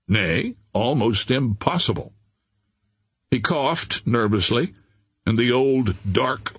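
usual speaking voice

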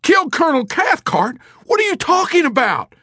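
high, loud, panicked shout; terrified roar. A narrator's reading. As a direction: shout it in horrified disbelief. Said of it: "he roared".

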